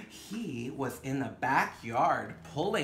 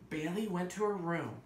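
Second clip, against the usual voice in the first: gentle storybook narration with wonder; story reading to children; 6 semitones higher, 4 dB louder than usual. Acted. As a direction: read it like a bedtime picture book. Reads picture book aloud.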